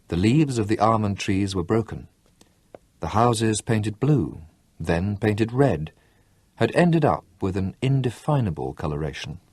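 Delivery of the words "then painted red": usual speaking voice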